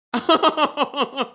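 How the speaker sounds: loud high-pitched cackle. Real, not acted. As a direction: burst into a shrieking laugh. Cackles after calling both weird.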